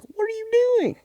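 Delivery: very high, squeaky mock yell; high kid yell. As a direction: shriek it like a panicked kid. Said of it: "She's yelling at her".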